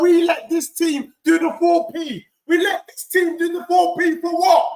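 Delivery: high, heated shouted rant. Real, not acted. Fan berating Arsenal supporters, heated shouting rant. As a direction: rage at Arsenal for not helping.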